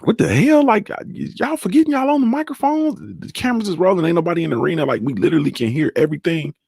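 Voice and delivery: high pitched exasperated disbelief; incredulous outburst. Retold or imitated. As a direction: squeal in amused disbelief. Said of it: "I was like".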